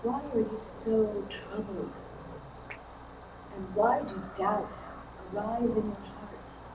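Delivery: soft, slow, searching words; slow tender question. Acted. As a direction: draw it out tenderly. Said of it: "Jesus said to us".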